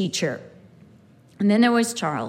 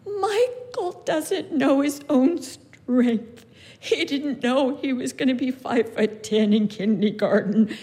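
tearful explanation. Retold or imitated. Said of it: "I said".

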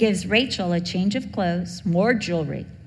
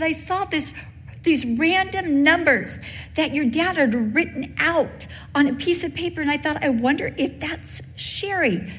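much higher, a lilting imitation of mom; imitating mom on phone; 8 semitones higher than usual. Mom surprising her daughter on the phone, high imitated mom voice. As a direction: pitch way up to voice her mother's surprised call.

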